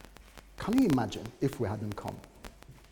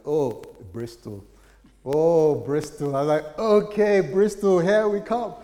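swelling from slow sigh to loud, much higher joy; building excitement; 6 semitones higher, 12 dB louder, slower than usual. Retold or imitated. Pastor warming to the move, growing loud and elated. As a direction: let it grow until you burst out. Falling in love with Bristol.

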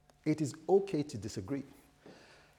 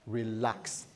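low, soft reassurance; calming pastor; 3 semitones lower, 5 dB quieter than usual. Acted. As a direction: settle down an upset husband quietly. Pastor calming the shouting husband.

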